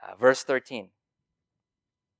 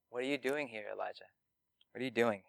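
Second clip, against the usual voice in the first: a quiet, gentle question, much softer; soft still small voice; 10 dB quieter, faster than usual. Acted. God softly asks the hiding prophet, gentle and quiet.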